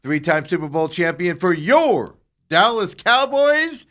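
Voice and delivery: big, high-energy radio intro with drawn-out words; stretched, high, loud host introduction; show-opening announcer. Acted. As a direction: bellow the welcome like a ring announcer.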